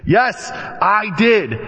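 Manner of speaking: slow, high, emphatic insistence; mock furious wife. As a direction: imitate an indignant wife digging in.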